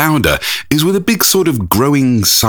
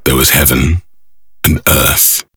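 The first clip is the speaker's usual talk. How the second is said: epic narration; 7 semitones lower than usual. Acted. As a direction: intone it like a film trailer.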